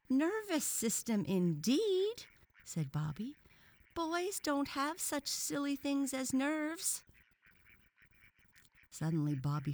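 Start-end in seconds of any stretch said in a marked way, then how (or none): 0.1-2.3 put-on high, indignant boy voice; scornful boy. A narrator's reading. Boy scoffs at the professor. 3.9-7.0 put-on high, slow scoffing boy voice; dismissive boy. A narrator's reading. Boy scoffs at idea of nerves.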